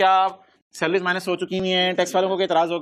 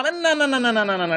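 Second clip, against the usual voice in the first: high-pitched string of no-no-no; emphatic refusal; 8 semitones higher than usual. Acted. Teacher stopping the class from deducting twelve, emphatic refusal. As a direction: reject it repeatedly in a raised pitch, falling as it goes.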